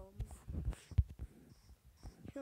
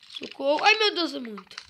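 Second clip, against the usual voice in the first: much higher, louder panicked yell; begging for help in real fear; panicked cry for help; 7 semitones higher, 17 dB louder than usual. Real, not acted. Overwhelmed by mobs. (pedido de socorro em pânico)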